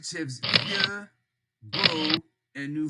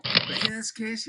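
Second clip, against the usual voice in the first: ordinary talk, nothing marked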